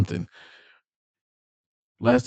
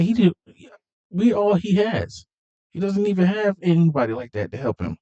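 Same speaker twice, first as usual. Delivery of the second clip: urgent plea; 10 semitones higher than usual. Retold or imitated. Dom keeps pleading.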